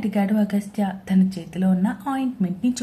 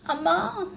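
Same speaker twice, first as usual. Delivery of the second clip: high yelp of pain; cry of pain; 8 semitones higher, 3 dB quieter, slower than usual. A narrator's reading. Cousin cries out falling, yelp of pain. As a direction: cry out as she falls. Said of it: "అని అరుస్తూ".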